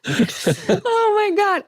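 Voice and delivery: very high, drawn-out slow exclamation; high slow mock outrage; mock-shocked. Real, not acted. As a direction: stretch it out in outraged amusement. Reacting to being teased.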